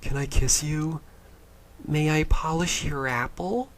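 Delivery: high, slow, simpering ask; mock polite suitor. Mocking a movie suitor asking for a kiss, high and prim. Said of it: "You say".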